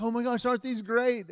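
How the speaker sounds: a gushing "aren't these great"; excited wife. Retold or imitated. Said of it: "she's like".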